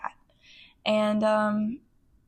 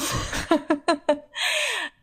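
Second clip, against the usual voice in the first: bright self-amused laugh; 7 semitones higher than usual. Real, not acted. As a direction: laugh at your own joke. Laughs at own nickname.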